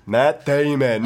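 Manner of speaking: slow, dopey puppet voice saying the name; Team America Matt Damon. Retold or imitated. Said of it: "you want me to do my Matt Damon accent or impression".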